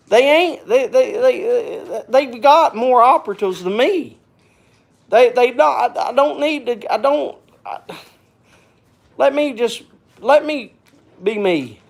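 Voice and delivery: high, agitated and flustered; stammering, getting heated and upset; worked up. Real, not acted. Venting about the three-hour drive, worked up. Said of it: "I get worked up, sorry".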